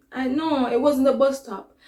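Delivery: ordinary delivery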